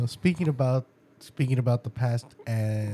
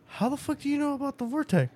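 shocked question; 12 semitones higher, faster than usual. Retold or imitated. Dad's shocked question in retelling.

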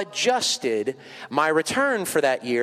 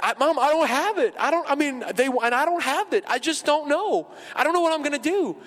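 high, whiny, helpless complaint; whining; 11 semitones higher than usual. Retold or imitated. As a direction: whine like you have no money at all. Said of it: "whining, whining".

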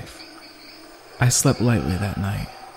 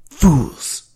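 sharp, high angry hiss; 6 semitones higher, 4 dB louder than usual. A narrator's reading. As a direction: spit the word at them. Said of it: "he hissed in an angry whisper".

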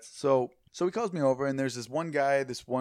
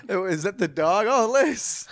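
higher, louder put-on voice; mock exclamation; 6 semitones higher, 5 dB louder, slower than usual. Joking to a friend about the dog Liz, mock exclamation. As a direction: rise into a teasing mock cry.

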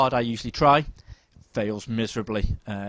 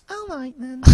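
much higher put-on voice of a friend; imitating a high, sing-song friend's voice; Steve's voice; 7 dB quieter than usual. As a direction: pitch it way up to mimic a mate. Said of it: "sort of voice".

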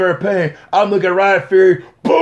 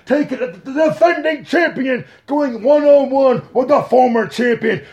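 wrestling hype announcer; 6 semitones higher than usual. Acted. Announcer hyping the rematch to the fans, booming. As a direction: sell the rematch to the crowd.

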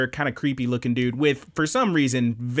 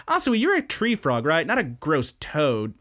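distracted, curious girl; 3 semitones higher than usual. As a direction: imitate a girl firing off curious questions. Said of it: "she's just geeking out".

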